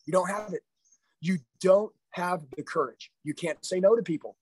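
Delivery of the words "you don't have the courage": slow, weighty repeat; slow emphatic. Real, not acted. Coach repeats to his sales team that they lack courage, heavier still. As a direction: say it again, slower and heavier. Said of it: "repeated for emphasis".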